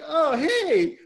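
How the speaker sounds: high, laughing, delighted imitation of him; overjoyed greeting. Husband greeting his wife, high and laughing with joy. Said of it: "And he's like".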